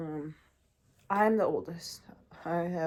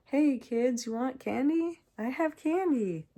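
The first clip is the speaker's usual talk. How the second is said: a higher, lightly voiced candy-offering creep; typical creepy stranger; 6 semitones higher than usual. Stranger in a car luring kids with candy, lightly mocked. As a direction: sweet-talk the kids like a cliché stranger. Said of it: "Like the typical".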